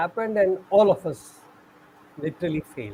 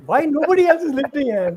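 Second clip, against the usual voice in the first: put-on puzzled boy; 7 semitones higher, 5 dB louder than usual. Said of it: "looking at it like".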